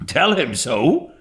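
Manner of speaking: retorting. A narrator's reading. Servant retorting to her mistress, indignant. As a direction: toss the question back indignantly. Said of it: "retorted Peggotty".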